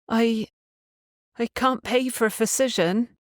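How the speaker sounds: put-on a worried girl stammering; worried Mavorin. Girl telling the doctor she can't pay, hesitant.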